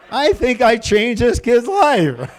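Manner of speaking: ordinary talk, nothing marked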